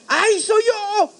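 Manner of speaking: high, long-drawn cry; shocked realization. Retold or imitated. Survivor discovers the screams are his own, wailed realization. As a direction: wail the discovery comically, stretching the end.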